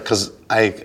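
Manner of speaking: ordinary talk, nothing marked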